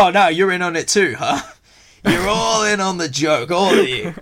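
mock accusing his friends, laughing; mock paranoid. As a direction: laugh while playing the accuser. Voicing his own paranoid self.

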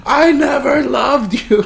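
slow, high mock shout; drawn-out melodramatic holler out the window. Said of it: "He's just shouting out the window like".

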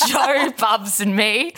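normal talking voice

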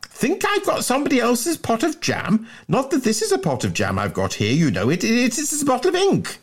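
high-pitched blustering schoolboy; indignant. Acted. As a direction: bluster high, then stutter into a fib. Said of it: "roared Bunter indignantly".

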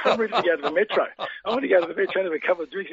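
put-on fast, keyed-up young player; a hint of an impatient twenty-one-year-old; eager young self. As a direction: rattle it off, itching to hit the pub. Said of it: "I said".

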